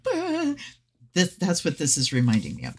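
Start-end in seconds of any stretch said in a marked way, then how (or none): 0.0-0.5 high imitated wail; mock burst of sobbing. Reader retelling her reaction to the medium, mock sobbing. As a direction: imitate suddenly bursting into sobs. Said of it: "I just burst into sobs".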